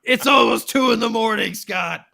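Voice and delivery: loud, high exasperated imitation; imitating a fed-up co-host, loudly; mock shout. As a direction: yell a hammed-up complaint. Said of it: "Samu was just like".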